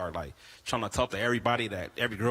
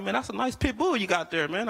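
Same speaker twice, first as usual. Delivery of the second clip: a higher voice, overly impressed; mock admiring; 10 semitones higher than usual. Comic humoring a pit bull seller, mock admiring. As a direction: fake being impressed.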